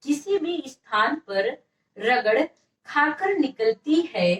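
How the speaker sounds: slow phrases with pauses between; slow dictation. Shown as a demonstration. Teacher repeats definition for note-takers, slow dictation. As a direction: dictate it in small slow chunks. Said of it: "definition लिख लीजिए".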